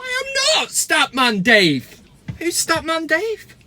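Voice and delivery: hammed-up innocence, drawn out; mock indignant denial. Mock denial of being Statman Dave.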